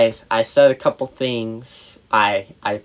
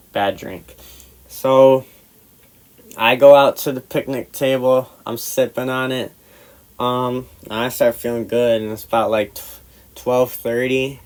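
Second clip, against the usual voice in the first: plain reading voice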